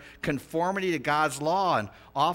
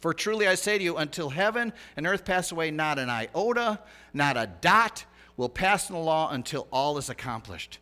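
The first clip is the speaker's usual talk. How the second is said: loud, raised-pitch sermon delivery; urgent preacher driving the point home; impassioned preaching; 6 semitones higher, 7 dB louder than usual. Real, not acted. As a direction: proclaim scripture with rising force.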